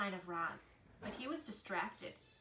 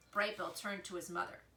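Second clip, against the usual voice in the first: usual speaking voice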